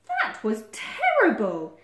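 loud, slow, drawn-out disapproval; bossy Badger passing judgment; scornful verdict. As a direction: pronounce it with exaggerated disdain. Badger scolds the animals.

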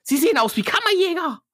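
indignant stockbroker; snooty broker voice. Acted. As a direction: look down your nose at them. Voicing the broker.

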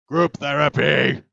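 very loud, slow proclamation; loud call-out. Pretend. Player calls the group to therapy, loud call-out. Calling out a game action.

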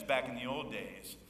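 ordinary talk

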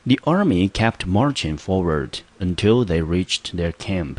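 slow example read. Shown as a demonstration. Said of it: "我们直接来看例句".

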